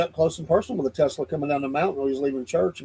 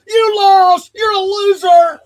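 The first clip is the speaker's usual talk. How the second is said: high, loud screaming game show host; screaming announcer; 9 dB louder, slower than usual. Retold or imitated. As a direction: scream it into the mic. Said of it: "he would be screaming in the microphone".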